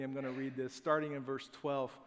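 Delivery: ordinary delivery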